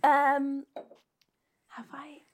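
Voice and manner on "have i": whisper. Real, not acted.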